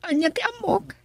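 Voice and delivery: ordinary talk, nothing marked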